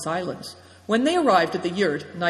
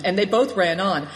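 ordinary delivery

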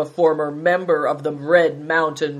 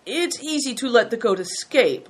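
higher, mild lament; rueful servant; 6 semitones higher than usual. A narrator's reading. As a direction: lament it mildly. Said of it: "lamented Hailstone".

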